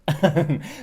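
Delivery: high burst of laughter; loud laugh. Real, not acted. Reader laughing at the money joke.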